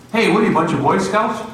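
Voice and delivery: high, fast, mocking sneer of a tough worker; mocking taunt. Railroad worker taunting the cross-carriers, mocking and fast. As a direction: sneer it like a bully.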